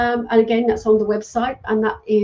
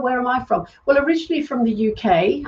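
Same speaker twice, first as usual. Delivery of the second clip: ordinary delivery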